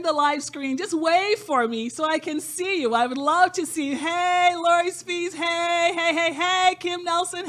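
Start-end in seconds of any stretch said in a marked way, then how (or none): 4.0-7.5 loud, high greeting hey; calling out hello. Real, not acted. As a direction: call out to the people on screen. Greeting sponsors on the live screen.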